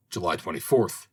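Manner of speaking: ordinary delivery, nothing marked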